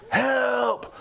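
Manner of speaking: a sudden high yelp of Help!; mock cry for help. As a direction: cry Help! like a man going under. Sinking Peter cries out.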